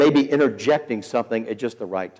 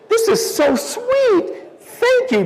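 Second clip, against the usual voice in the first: very high, slow gushing imitation; imitating tearful gratitude; 4 dB louder, slower than usual. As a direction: squeak it out as if moved to tears, hammed up. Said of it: "a little tear came".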